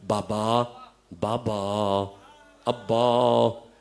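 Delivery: a weak small girl's call, lightly voiced; faint little voice. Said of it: "ایک نحیف سی آواز آئی". (ننھی سی نحیف آواز میں ہلکا سا پکارنا)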